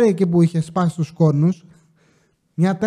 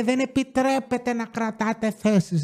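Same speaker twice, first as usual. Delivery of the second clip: crazy local woman; 3 semitones higher, 5 dB quieter than usual. Retold or imitated. Crazy local schools the parking attendant, mock-haughty voice. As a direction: put on the crazy resident's voice, drawing it out. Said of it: "έρχεται μία τρελή εκεί πέρα".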